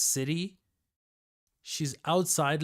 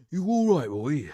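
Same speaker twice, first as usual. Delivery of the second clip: put-on Cockney accent; 3 semitones higher, 8 dB louder than usual. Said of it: "anyone with a Cockney accent".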